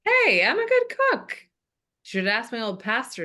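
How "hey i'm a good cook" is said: indignant protest. Acted. Defends his cooking.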